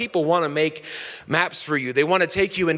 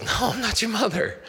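higher, softer character voice; storybook dog; 5 semitones higher, 4 dB quieter than usual. Retold or imitated. Dog answering the lost baby bird, high soft character voice. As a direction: voice a picture-book dog, pitched up and soft. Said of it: "the dog goes".

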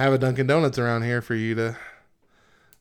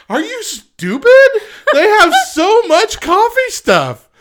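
high, loud, laughing mock rant; mock outrage; 8 dB louder than usual. Teasing co-host over Dunkin', laughing mock outrage. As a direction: rant in playful disbelief, far higher.

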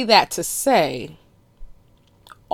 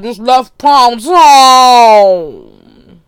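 drawn-out punchline; 4 semitones higher, 11 dB louder, much slower than usual. Real, not acted. Landing the joke for listeners, drawn out slowly. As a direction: Pound Town stretched very slowly and loudly.

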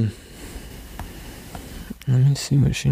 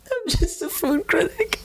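high, squeaky desperate plea; terrified pleading. Acted. Captive half-elf begging the party for mercy, squeaky. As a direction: beg like a cornered coward.

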